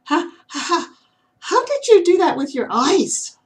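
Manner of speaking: put-on young squirrel giggling at the owl; nervous laugh. A narrator's reading. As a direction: laugh then blurt the question.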